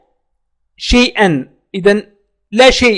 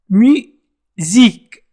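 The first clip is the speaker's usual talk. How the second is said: drawn-out word; much slower than usual. Shown as a demonstration. Teacher drawing out "Musique" for learners. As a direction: stretch "Musique" slowly, syllable by syllable. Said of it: "teacher models single word".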